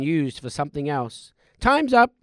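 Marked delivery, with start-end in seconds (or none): 1.6-2.1 high, loud announcement; loud call. Acted. Ghost calling time on Scrooge's visit. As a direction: call it out sharply.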